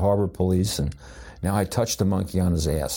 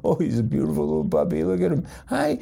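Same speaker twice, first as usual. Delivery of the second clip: much higher cooing puppy talk; baby talk; 12 semitones higher than usual. Retold or imitated. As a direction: coo at the puppy like a doting owner. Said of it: "they bring him up".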